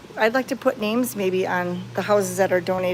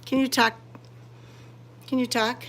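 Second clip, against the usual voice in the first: normal talking voice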